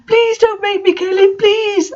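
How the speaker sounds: very high, squeaky pleading; mock begging. A narrator's reading. Ebony begging the villain in a squeaky high voice. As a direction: beg like a whiny teen. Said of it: "I begged".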